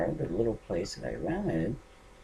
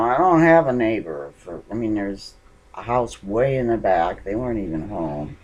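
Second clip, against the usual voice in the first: ordinary delivery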